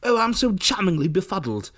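high-pitched bumbling English charmer; mock befuddled British film star; Hugh Grant. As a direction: put on a fluttery English accent. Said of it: "if you don't sound like Hugh Grant".

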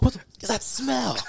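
high-pitched mock complaint; imitated complaining. Showing friend how he was complaining, high mock complaint. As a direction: whine it out in a very high voice. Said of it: "He was complaining".

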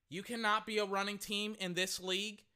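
normal talking voice

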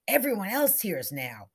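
usual speaking voice

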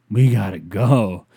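loud, slow insistent warning; urgent. Retold or imitated. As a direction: hammer it out, urgent. Boyfriend urging escape.

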